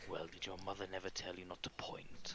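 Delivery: soft, condescending scolding; chiding villain. Acted. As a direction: scold a rude youngster softly.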